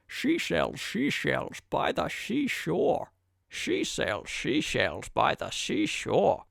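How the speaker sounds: slow, soft Scottish lisping tongue twister; lazy Scottish slur on every s, done softly and slowly; Sean Connery impression. Mic reviewer doing a Sean Connery tongue twister, slow soft Scottish voice. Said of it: "And now the voice of Sean Connery".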